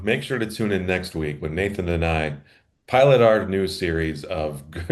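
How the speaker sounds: usual speaking voice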